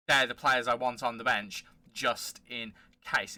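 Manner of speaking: ordinary delivery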